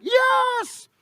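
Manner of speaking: high, drawn-out cheer; imitating an elated yell; mock gleeful shout. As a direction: shout it like a win. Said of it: "Saya teriak".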